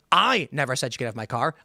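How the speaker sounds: "I" stretched long at the start; stress on I. Shown as a demonstration. Showing how emphasis changes meaning, "I" stressed. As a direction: land hard on "I", then run on. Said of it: "if you add emphasis on any one".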